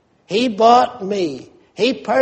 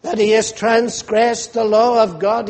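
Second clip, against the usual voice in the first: ordinary talk, nothing marked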